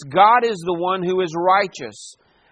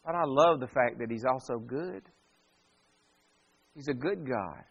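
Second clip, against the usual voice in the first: soft and much quieter, sincere; tender, hushed; 6 semitones lower, 9 dB quieter than usual. Real, not acted. Preacher tells the congregation God is good, softly tender. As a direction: let the love for a good God show softly. Tender aside on God's goodness.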